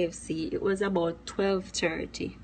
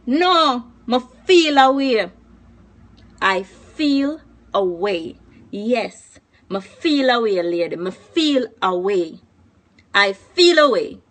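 loud, higher, drawn-out indignant protest; angry outburst; 4 semitones higher, 11 dB louder, slower than usual. Acted. Customer yelling at the KFC lady about the missing zinger, angry outburst. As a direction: refuse the apology, furious.

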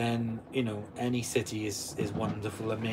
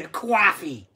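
loud hammed-up New York "Coffee"; New York accent; 5 semitones higher, 5 dB louder than usual. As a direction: stretch the vowel like a caricature New Yorker. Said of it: "that's how I talk".

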